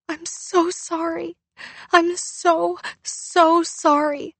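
high, slow apology muffled into her knee; stifled sobbing. Acted. Girl buries her mouth in her knee, sobbing sorry. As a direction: sob it into your knees. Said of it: "stifling her words".

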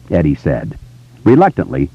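ordinary delivery, nothing marked

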